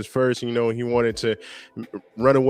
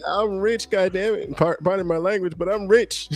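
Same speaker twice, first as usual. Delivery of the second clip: higher put-on bragging voice; mock boastful suitor; 8 semitones higher than usual. Knight bragging to Rhaenyra about his wealth, higher mock voice. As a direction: brag like a silly rich suitor. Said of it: "He's like".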